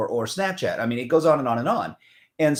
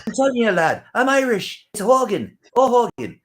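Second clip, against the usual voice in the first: high, loud mock Irish lad; mock Irish accent; 10 semitones higher, 4 dB louder than usual. Student jokingly insisting on being Irish, high mock Irish accent.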